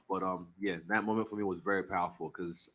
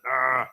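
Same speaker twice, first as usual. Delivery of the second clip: short triumphant shout; 4 semitones higher, 13 dB louder than usual. Retold or imitated. Mock opponent's triumphant cry.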